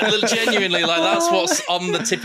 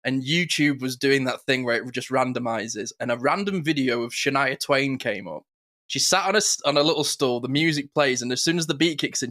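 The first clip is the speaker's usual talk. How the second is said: ordinary talk, nothing marked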